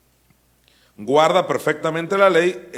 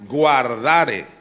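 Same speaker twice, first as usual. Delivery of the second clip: Guardare said alone, louder and falling; stress on Guardare; 6 dB louder than usual. Shown as a demonstration. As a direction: punch the word Guardare. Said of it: "¿en qué términos lo dijo Pablo?".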